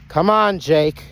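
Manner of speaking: loud, high-pitched holler; calling out. Real, not acted. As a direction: call Jake back from a distance. Calling his runaway Jake back.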